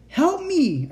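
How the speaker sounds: very high scream for help. A narrator's reading. Captive child as the buzzing roars, screaming. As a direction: scream out in terror. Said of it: "I screamed".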